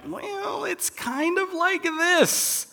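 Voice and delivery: high, slow, soft squeaky hedge; mock hedge. Mocking Bible translators who hedge on old words, squeaky.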